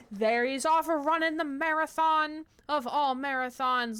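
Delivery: a touch of excited play-by-play; excited pet commentary. Real, not acted. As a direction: cheer him like a sports fan. Commentating the hamster run.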